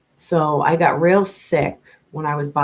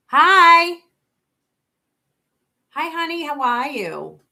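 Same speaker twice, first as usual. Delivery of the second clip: bright greeting; 10 semitones higher, 6 dB louder, slower than usual. Real, not acted. Greeting a viewer who just joined the stream, high and excited. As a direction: greet a friend in chat warmly.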